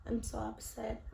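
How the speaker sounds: ordinary talk, nothing marked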